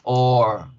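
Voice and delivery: "Or" as a long O with barely any R; lengthened vowel. Shown as a demonstration. Teacher retrying the vowel, "Or" drawn out. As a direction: stretch "Or" out, tongue loose. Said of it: "el hecho de alargar la vocal".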